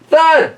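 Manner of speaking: high punchy thud; sound effect. Acted. Reading hammering noises, high punchy thud. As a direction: bang it out.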